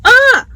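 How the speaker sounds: sudden high, loud "ah"; startled cry. Real, not acted. Ketchup falls while eating in the car, startled cry. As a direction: yelp as something drops.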